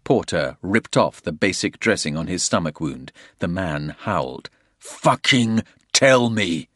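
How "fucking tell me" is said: slow, loud, menacing demand; angry demand. A narrator's reading. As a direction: lean on every word, furious. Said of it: "Porter said".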